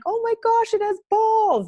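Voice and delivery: high, loud shocked scream; mother screaming at birth, shocked loud shriek. Retold or imitated. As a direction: shriek it in disbelief. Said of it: "That's what I screamed out".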